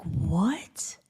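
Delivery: unvoiced disbelieving what; whispered what in disbelief; stunned whisper. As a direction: breathe it out in shock. Hearing the owner's name.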